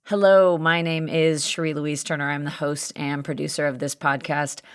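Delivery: ordinary talk, nothing marked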